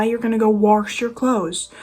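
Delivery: "wash" said as "warsh"; mispronounced wash. Retold or imitated. Scolding people who say it wrong, "wash" with an intrusive R. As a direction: put an R before the sh in "wash". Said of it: "There's no R in there".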